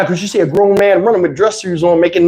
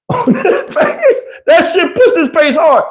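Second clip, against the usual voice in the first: high, squeaky laugh through the words; 11 semitones higher, slower than usual. Real, not acted. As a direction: crack up while describing it. Laughing at the welt.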